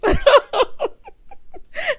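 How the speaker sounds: loud, high cackling laughter; big laugh. Real, not acted. Host cracking up over the gift bags, big laugh. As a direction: burst out laughing at your own dig.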